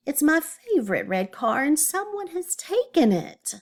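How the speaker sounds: put-on high-pitched childish complaint; whining. A narrator's reading. Oscar complaining to mom about his car, whining. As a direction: whine upward, indignant about the loss. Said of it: "whined Oscar".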